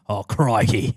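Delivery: put-on Aussie accent; Australian accent. Aussie facing a car gator, Australian accent. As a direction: do a mock Australian. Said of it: "Oh, if it's an Aussie".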